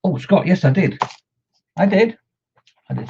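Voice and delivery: gleeful answer, rising each time; excited. Real, not acted. Answering Scott about buying the rum balls, excitedly.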